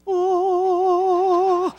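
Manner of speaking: a high, thin, meek little ooh; timid ooh. Pretend. Mock timid Jesus entrance, high meek ooh. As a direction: shrink into a tiny sound. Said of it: "he didn't just go".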